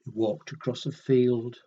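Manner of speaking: usual speaking voice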